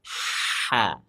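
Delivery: "H" desah dari tenggorokan tengah yang disempitkan; ha sound. Shown as a demonstration. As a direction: hembuskan angin dari tenggorokan. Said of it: "dipaksa keluar anginnya". (ha tenggorokan)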